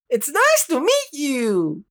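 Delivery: high, slow, innocent greeting; naive little bird. Acted. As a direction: greet him sweetly, unaware.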